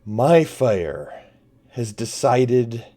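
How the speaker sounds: loud, grand pronouncement broken by weighty pauses; booming voice. Acted. Villain with the dagger declares his mercy, booming voice. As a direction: proclaim it like a merciful overlord, booming. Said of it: "He says again in this booming voice".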